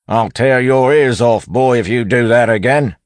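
loud, high constable roar; bellowed threat. A narrator's reading. Wayne loudly threatening the bandit for show, bellow. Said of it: "Wayne bellowed, swapping back to the constable accent".

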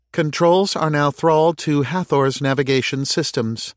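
Anubis's AI. A narrator's reading. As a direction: report a system status as the ship's AI. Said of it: "responded Anubis's A I".

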